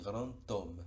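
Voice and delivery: "grand homme" said slowly, the D linked as a T; D sounding as T. Shown as a demonstration. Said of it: "esa D suena como T". ("grand homme" despacio, la D suena como T)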